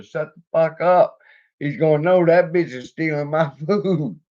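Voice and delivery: high, loud, laughing yell; yelling at the dog. Retold or imitated. Yelling at Boomer to shut up, loud and high. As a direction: bark it at the dog to hush him.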